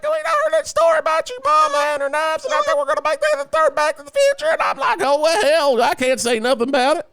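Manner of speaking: very high squeaky falsetto; squeaky high voices. Retold or imitated. Host imitating Zemeckis and Gale in very high squeaky voices. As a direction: squeak it way up like a cartoon. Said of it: "their voices are so goddamn high".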